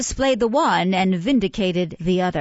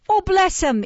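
gushing woman; 12 semitones higher, 3 dB louder than usual. A narrator's reading. Mrs Mann feigns tenderness.

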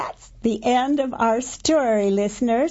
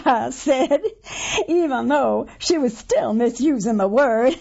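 ordinary delivery

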